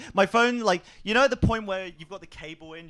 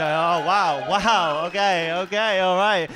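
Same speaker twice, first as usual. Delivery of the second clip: mock shocked reaction; 5 dB louder, slower than usual. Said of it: "absolutely go".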